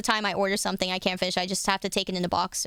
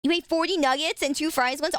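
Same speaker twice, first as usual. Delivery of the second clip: ordinary delivery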